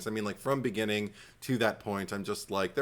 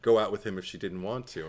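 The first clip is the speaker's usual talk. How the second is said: normal talking voice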